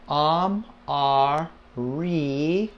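"am, R, re" broken into separate syllables. Shown as a demonstration. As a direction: sound out "am, R, re" piece by piece, "re" with an ee sound. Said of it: "the I makes the E sound".

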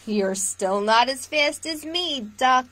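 lively storytime read-aloud; picture-book voice for little listeners; reading to children. Acted. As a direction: read it warmly to a room of kids. Reads picture book to viewers.

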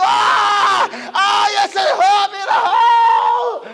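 high, drawn-out wailing shout; acted crying out. Friends seeing Job from afar, acted crying out. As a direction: wail it out as if calling from afar. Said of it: "se quedaban gritando".

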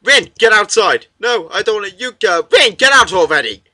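loud, high-pitched frantic order; acted panicky yelling; urgent shouting. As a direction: shout them out of the room. Acting urgent quarrel between characters.

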